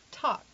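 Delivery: "talk" with open ah, tahk; open, unrounded vowel in "talk"; standard American accent. Shown as a demonstration. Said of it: "the standard American accent says".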